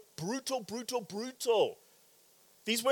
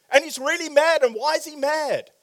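ordinary delivery

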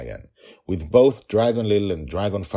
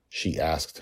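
ordinary delivery, nothing marked

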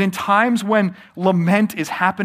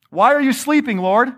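pleading cry; 7 semitones higher, 3 dB louder than usual. Retold or imitated. Quoting the psalmist's plea.

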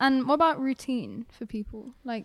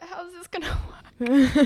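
mock question cracking into laughter; higher, louder, breaking up laughing; laughing punchline; 5 semitones higher, 6 dB louder than usual. Telling a meme, cracking up.

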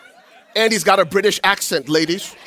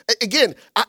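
normal talking voice

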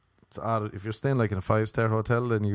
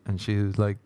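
ordinary delivery

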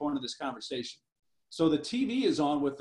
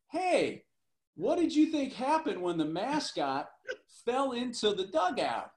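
higher, teasing smart-aleck question; 7 semitones higher than usual. Retold or imitated. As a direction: needle him with a sly question. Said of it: "the smart aleck that he can be".

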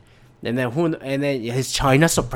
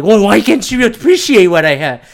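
loud, ranting Trump impression, lightly voiced; mock Trump bragging and griping, louder; a loose, hammed-up Trump rant; 3 semitones higher, 7 dB louder than usual. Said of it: "he comes back and like".

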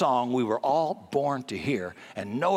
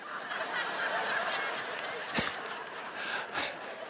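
soft laugh; 11 dB quieter than usual. Real, not acted. Laughing at own joke.

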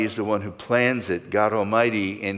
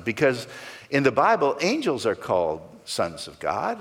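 ordinary talk

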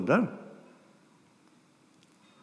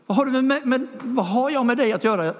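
shrieking possessed man; 10 semitones higher than usual. Acted. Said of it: "varför ropar de så här hela tiden". (hög, ropande röst som en besatt man)

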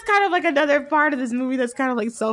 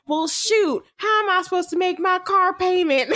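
put-on country bumpkin accent; 6 semitones higher than usual. Dethroned festival king turns into hammed-up country bumpkin accent. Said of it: "country bumpkin".

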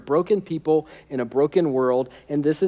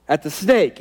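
usual speaking voice

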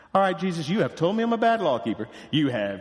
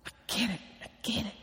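softly, almost a whisper, I get it; a near-whispered dawning realization; hushed realization; 11 dB quieter, much faster than usual. Retold or imitated. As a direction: breathe it out in quiet wonder.

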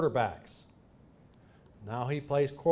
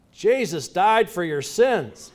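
yelled; 4 semitones higher, 7 dB louder, slower than usual. Retold or imitated. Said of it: "the worst thing I ever yelled at an official".

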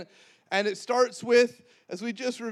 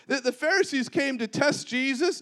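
ordinary talk, nothing marked

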